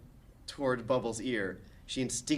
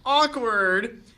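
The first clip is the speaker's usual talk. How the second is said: loud awkward; 12 semitones higher, 11 dB louder than usual. Acted. Narrator comments on scene.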